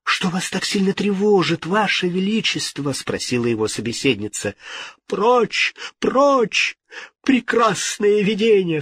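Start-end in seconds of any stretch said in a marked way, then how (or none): 0.1-2.9 higher-pitched concerned lady; lady's voice. A narrator's reading. Lady asking the troubled king, high-voiced. 5.1-8.8 high-pitched panicked cries; frightened outcry. A narrator's reading. King driving off a vision, frightened. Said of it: "вскричал Генрих".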